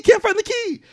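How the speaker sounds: very high-pitched mock-frantic wail; imitating panic over a lost object; retold panic. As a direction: cry it out in hammed-up desperation.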